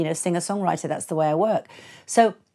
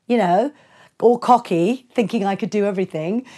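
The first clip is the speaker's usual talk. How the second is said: ordinary talk, nothing marked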